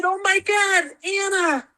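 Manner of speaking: high-pitched, awed exclamation; amazed cry. Retold or imitated. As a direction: relive the shock of seeing your idol. Retells meeting her idol's ghost.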